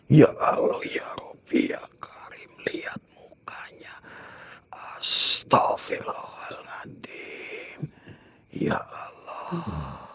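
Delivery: loud scared whisper; frightened whisper. Real, not acted. Whispering in terror beside the ghost, scared whisper. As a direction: gasp it out in a panicked whisper.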